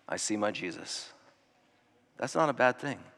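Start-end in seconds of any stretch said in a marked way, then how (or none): 0.1-1.1 soft, low, peaceful wonder; peaceful awe. Retold or imitated. The risen believer telling of seeing Jesus, softly in awe. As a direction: hush it in quiet awe. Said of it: "All they'll say is".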